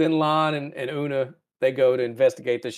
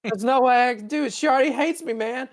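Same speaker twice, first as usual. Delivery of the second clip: put-on high, whining teenage complaint; whiny human Spock; 11 semitones higher than usual. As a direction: whine like a sulky kid.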